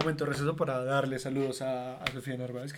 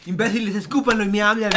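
burla exasperada; 4 semitones higher, 5 dB louder than usual. Retold or imitated. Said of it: "y yo soy".

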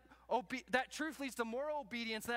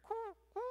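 failed train noise; 13 semitones higher than usual. Shown as a demonstration. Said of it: "I can't even make a train noise".

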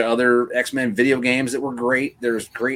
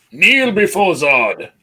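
higher, louder, slow villain command; booming arrogant alien overlord; 7 semitones higher, 5 dB louder, slower than usual. Retold or imitated. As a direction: boom it like a conquering tyrant. Quoting Zod in Superman debate.

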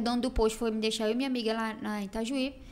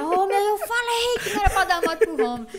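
mock scolding father; 8 semitones higher, 5 dB louder than usual. Father scolding in retold story.

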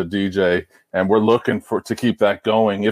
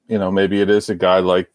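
ordinary talk

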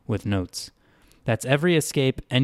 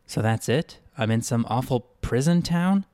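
usual speaking voice

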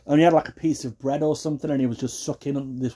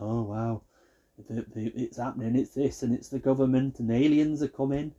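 hushed, soft wonder, mocking; mock conspiracy theorist; 2 semitones lower, 5 dB quieter than usual. Conspiracy theorist on strange events, mock hushed awe. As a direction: imitate an awed believer under your breath.

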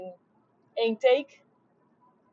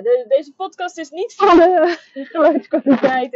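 laughing; 7 semitones higher, 13 dB louder than usual. Real, not acted. Laughing at bad audio.

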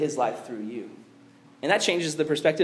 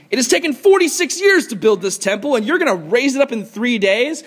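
incredulous protest; 6 semitones higher, 6 dB louder than usual. Retold or imitated.

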